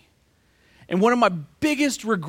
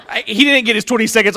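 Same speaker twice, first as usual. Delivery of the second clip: ordinary delivery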